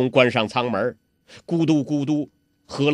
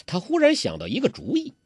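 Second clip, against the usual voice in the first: plain reading voice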